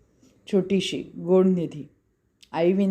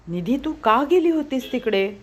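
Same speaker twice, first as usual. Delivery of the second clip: put-on a higher, sharp yell at the little girl; shouting at sister; 6 semitones higher than usual. A narrator's reading. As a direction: scold her for going there. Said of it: "तो निधीवर ओरडला". (बहिणीवर ओरडणे)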